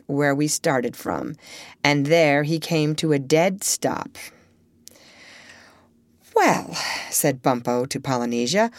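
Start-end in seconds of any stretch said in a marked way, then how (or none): 6.4-6.8 high, drawn-out falling well; pointed, high-pitched opener; challenging. A narrator's reading. Bumpo taunts Polynesia about beetle.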